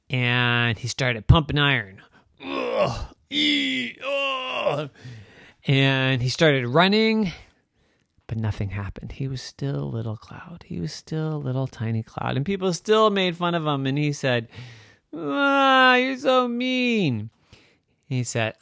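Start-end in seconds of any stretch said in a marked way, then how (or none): 2.4-4.9 straining grunts. A narrator's reading. Straining lifting iron. 15.1-17.3 high, slow wounded whine; hurt whine. A narrator's reading. Hurt cloud complains.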